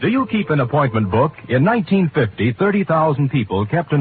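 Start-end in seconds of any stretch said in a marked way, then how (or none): none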